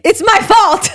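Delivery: teasing high-pitched impersonation; quick self-blame. Retold or imitated. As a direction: mimic them jumping to take the blame. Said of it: "quick to be like".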